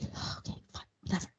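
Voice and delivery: very quiet, whispered concession; grudging whisper. Retold or imitated. Acting out Nina giving in to her husband, grudging whisper. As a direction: imitate giving in under the breath. Boss backs down grudgingly in retelling.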